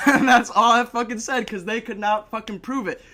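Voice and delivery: loud, high, through a laugh; gleeful laughing delivery. Real, not acted. Storyteller delighted at his two-word court defence, loud laughing delivery. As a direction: crow it while laughing.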